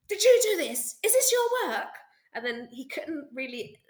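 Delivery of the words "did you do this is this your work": much higher and louder, thrilled questions; teacher rushing up, overjoyed; excited disbelief. Retold or imitated. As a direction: burst out in amazement at the drawing. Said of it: "rushed up to him ... and said".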